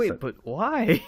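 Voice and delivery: high, drawn-out plea; baffled disbelief. Real, not acted. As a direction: stretch "why" upward in bewilderment. Baffled by the keyboard choice.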